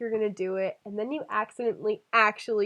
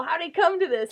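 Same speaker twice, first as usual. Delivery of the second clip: mock belted line; 5 semitones higher, 5 dB louder, faster than usual. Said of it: "I belt out".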